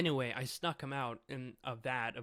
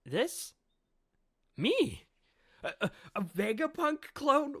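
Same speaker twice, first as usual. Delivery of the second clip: stunned, squeaky high pitch, rising louder; shocked disbelief; 12 semitones higher, 8 dB louder than usual. Retold or imitated. Lilith gasping at the news she is a clone, shocked high voice. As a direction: voice climbing high and loud in disbelief. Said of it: "And Lilith is just like".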